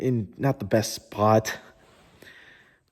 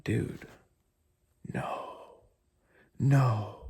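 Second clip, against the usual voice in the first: breathy, barely voiced despair; hopeless whisper; 3 semitones lower, 10 dB quieter, much slower than usual. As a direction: whisper it like giving up. Depressed again two days later.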